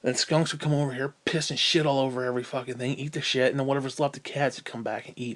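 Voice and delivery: ordinary talk, nothing marked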